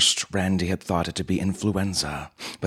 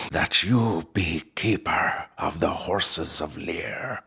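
slow, breathy final whisper; solemn last words, whispered slowly; dying whisper; slower than usual. Acted. Dying uncle passes on duty.

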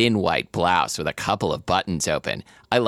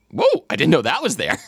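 startled gasp of discovery; surprised; 7 semitones higher than usual. Acted. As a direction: react like you just noticed. Surprised reading aloud.

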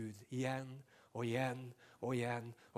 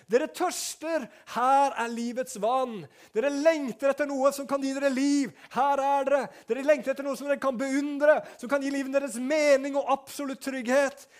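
loud, high, pleading invitation; preaching at full force; 13 semitones higher, 13 dB louder than usual. Acted. Pastor voicing God's invitation to the thirsty, loud. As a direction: urge them to come, full voice.

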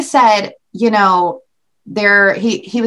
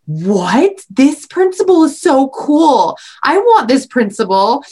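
louder, slow mock-amazed kids; 4 semitones higher, 3 dB louder, slower than usual. Her kids reacting to the video, lightly voiced amazement. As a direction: play the awed kids. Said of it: "they were like".

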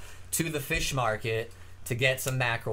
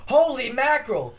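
holy mackerel exclaim; 10 semitones higher, 6 dB louder than usual. Retold or imitated. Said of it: "he goes".

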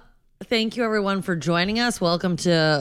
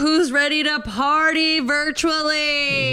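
whooping hype-man call; rowdy party animal; 9 semitones higher, slower than usual. Acted. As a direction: pump up the crowd. Hyped podcast opening party call.

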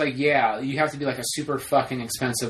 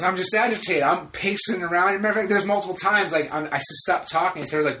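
ordinary talk

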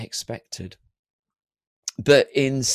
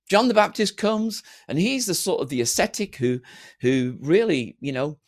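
ordinary delivery

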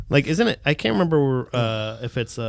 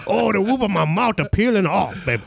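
higher, whiny Creole parody; mock Creole accent; 4 semitones higher than usual. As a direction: ham up a Creole diner with a scalded mouth. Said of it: "That's just the Creole accent".

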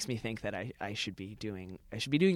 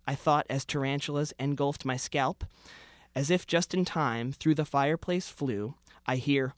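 rhythmic storytelling read of a comic Christmas poem; comic holiday poem recitation; 6 dB louder than usual. Acted. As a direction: read it aloud with a mock-festive lilt. Reads his Christmas parody poem.

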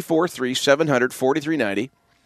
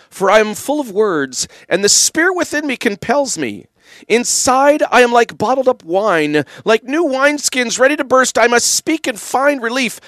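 lightly dramatic reading, a touch higher; a hint of a theatrical drama-teacher read; Justin Trudeau impersonation; 7 semitones higher than usual. Shown as a demonstration. Radio host reads scripture as Justin Trudeau, lightly theatrical. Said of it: "in my best Justin Trudeau impersonation here".

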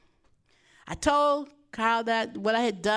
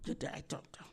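breathy whisper, almost voiceless, much softer; whispered aside; 11 semitones lower, 16 dB quieter than usual. Retold or imitated. Pastor whispering the receptionist's brush-off to the congregation. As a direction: drop to a mocking whisper on the repeat.

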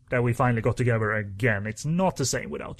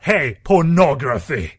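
loud, slow eager shout; excited mock shout; 3 semitones higher, 9 dB louder, slower than usual. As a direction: cheer it like an eager member. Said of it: "post topics about".

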